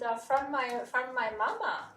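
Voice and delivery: put-on Russian accent, drawn out slowly. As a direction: quote the child through the teacher's Russian accent. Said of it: "Very thick Russian accent".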